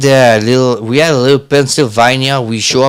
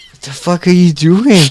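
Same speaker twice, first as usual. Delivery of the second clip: retold bafflement; 6 semitones higher than usual. Retold baffled reaction to the chanting.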